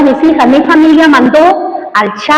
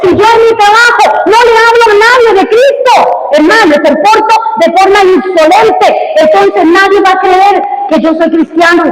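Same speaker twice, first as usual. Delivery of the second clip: raised, driving pulpit voice; fiery preaching; 6 semitones higher, 3 dB louder than usual. Acted. Pastor urges his congregation to compassion, fiery preaching. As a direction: preach it hard and pushing.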